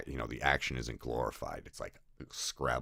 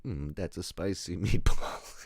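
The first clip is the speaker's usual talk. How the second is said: slow hammed-up Italian accent, laughing through it; a cartoon Italian accent savoring the line; mock Italian guy; 3 semitones higher, 3 dB louder than usual. Eisenstein in a mock Italian accent, laughing. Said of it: "He's an Italian guy now".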